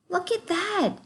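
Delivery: high, wondering; mock amazement. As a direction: sound amazed for the children.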